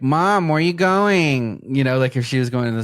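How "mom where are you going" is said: put-on slow, higher whiny kid voice; nagging kid. Kid nagging mom as she leaves, slow whine. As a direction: drag it out like a pestering child. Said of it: "we'd be like".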